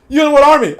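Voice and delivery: loud, high jeer breaking into laughter; hammed-up challenge, cracking up; laughing mock taunt. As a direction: belt out the taunt, amused. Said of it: "looked at them and said".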